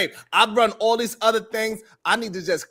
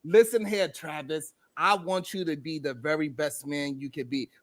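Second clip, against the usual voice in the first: much softer, mock-tender encouragement; tender coaching; 6 semitones lower, 7 dB quieter than usual. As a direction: coax him gently like a devoted partner. Partner voiced in tender pep talk.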